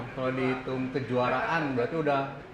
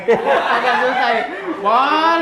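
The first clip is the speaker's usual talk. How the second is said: loud, high laughing question; 11 semitones higher, 13 dB louder, much slower than usual. Real, not acted. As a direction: crack up while asking. Struggling to open the coffee.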